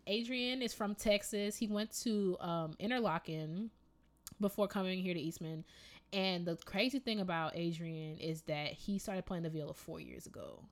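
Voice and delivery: ordinary talk, nothing marked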